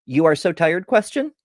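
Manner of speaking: statement tone, then a sharply rising question; flat statement, tagged question. Retold or imitated. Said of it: "says the sentence as a statement and then finishes".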